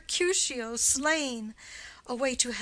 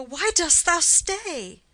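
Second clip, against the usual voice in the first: normal talking voice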